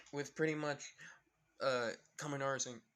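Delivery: ordinary delivery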